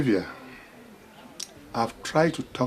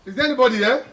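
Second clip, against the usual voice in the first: calling out; 10 semitones higher, faster than usual. Acted. Father calling, growing louder.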